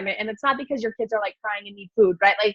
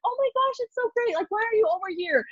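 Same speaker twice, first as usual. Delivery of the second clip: high-pitched excited relative, imitated; gushing surprised greeting; 10 semitones higher than usual. Relatives run into her at Walmart, imitated gushing greeting. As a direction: gush in a much higher voice, mimicking a relative.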